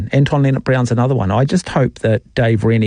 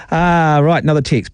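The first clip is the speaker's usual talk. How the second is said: ordinary delivery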